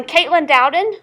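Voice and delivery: loud classroom name call; projected teacher's roll call. Real, not acted. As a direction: call the name out to find the student.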